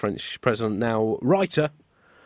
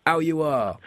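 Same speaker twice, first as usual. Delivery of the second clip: Frenchly; 4 semitones higher than usual. Acted. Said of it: "said the decapitated Queen Frenchly".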